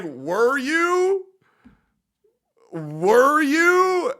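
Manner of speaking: high, loud incredulous repeat. Retold or imitated. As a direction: ask it in total disbelief. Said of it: "I was like".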